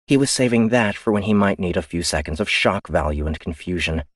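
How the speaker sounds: ordinary delivery, nothing marked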